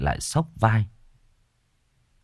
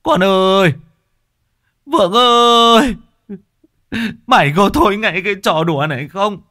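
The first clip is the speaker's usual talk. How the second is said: wailing cry; 12 semitones higher, 7 dB louder than usual. Acted. Father on the riverbank wailing for his missing son. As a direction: howl the son's name across the river in grief. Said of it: "khóc váng cả lên".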